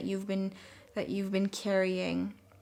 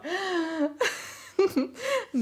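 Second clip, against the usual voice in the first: real giggle; 10 semitones higher than usual. Real, not acted. Giggly over the cards.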